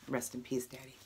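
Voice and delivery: soft, choked-up goodbye to her late dad; tearful whisper of rest in peace; emotional farewell. Real, not acted. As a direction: let the voice fade to almost nothing on Daddy. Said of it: "if I get emotional".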